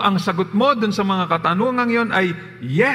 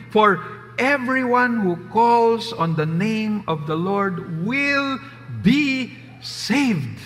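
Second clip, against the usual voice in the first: slow, emphatic, raised Bible reading; scripture reading; 3 semitones higher, 3 dB louder than usual. Acted. Preacher reading Romans aloud, slow and emphatic. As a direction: proclaim the verse to the congregation.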